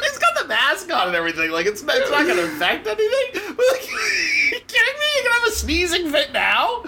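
high, laughing and incredulous; laughing disbelief. Real, not acted. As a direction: laugh through the outrage.

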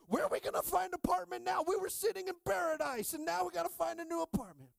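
high, loud whining complaint; hammed-up exiles griping; mock whining couple. Adam and Eve griping about finding an apartment, high whine.

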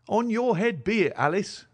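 high-pitched, exasperated outcry; mother's restrained cry of reproach; distressed cry. A narrator's reading. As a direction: cry it out, pitched well up but not shouted. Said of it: "cried her mother".

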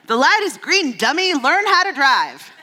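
high, loud mock child voice scolding a driver; bossy toddler. Daughter in car seat scolding mom, in a high, bossy child voice. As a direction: play the little girl in the back seat, sassy and high. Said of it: "she goes".

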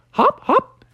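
high sing-song hop, hop; hopping sounds. Acted. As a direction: bounce the words up high. Stinky hopping pieces.

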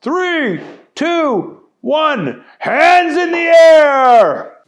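high countdown shouted, last line loud and slow; drawn-out loud "hands in the air" countdown; crowd yell. Acted. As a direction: call it out like a cheering crowd. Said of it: "the crowd yelled".

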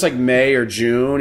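ordinary talk

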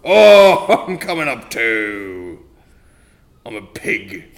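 normal talking voice

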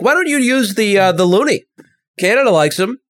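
high, lightly mocking tourist voice. Mock Irish tourist abroad.

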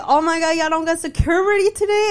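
imitating her own shocked reaction; mock disbelief. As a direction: act stunned at the news. Retelling her disbelief at workers.

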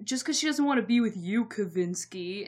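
taunting Josh. Retold or imitated. Josh voiced taunting Peter.

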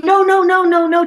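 high, loud mock no-no-no; emphatic rejecting voice; loud refusal. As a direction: push the food away out loud, no, no, no. Acting out refusing the food.

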